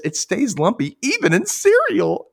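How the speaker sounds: high ad voice; girl voice. Retold or imitated. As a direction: chirp it like a cereal ad kid. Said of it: "She says".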